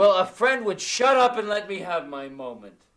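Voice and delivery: loud bitter retort; angry retort. Acted. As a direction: throw it back at the friend. Drunk man retorts.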